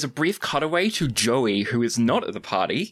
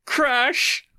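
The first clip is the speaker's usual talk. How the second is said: high, building gleeful squeal; hammed-up glee bursting to deliver the punchline; giddy excitement; 12 semitones higher, slower than usual. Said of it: "he's so excited to say it".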